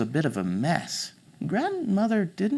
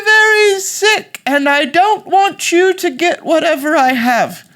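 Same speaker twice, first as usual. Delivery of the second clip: very high, loud, drawn-out granny falsetto; fake British grandmother; 9 dB louder, slower than usual. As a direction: play sick granny with a British accent, slowly. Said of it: "Why are you speaking with an accent".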